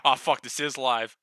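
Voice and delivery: ordinary talk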